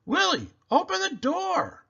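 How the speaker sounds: high, loud shout at a locked door; urgent shout. A narrator's reading. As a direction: bang on the door with your voice. Uncle at locked bathroom door.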